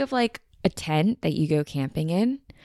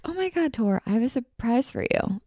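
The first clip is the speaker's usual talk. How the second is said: bright, higher excited mom imitation; mom's voice; 6 semitones higher than usual. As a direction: play mom gushing about a surprise. Mom's excited surprise quote.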